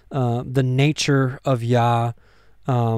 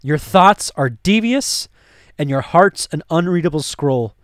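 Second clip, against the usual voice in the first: dramatic reading of Pilate; 3 semitones higher than usual. Acted. Reads Pilate's speech.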